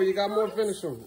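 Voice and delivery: normal talking voice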